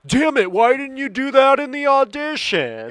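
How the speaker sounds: high, loud mock outburst; exasperated shout. Friend retells a film scene, loud high mock yell. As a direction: throw up your hands and yell it.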